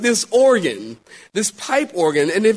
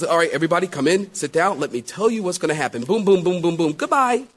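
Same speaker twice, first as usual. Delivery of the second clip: ordinary delivery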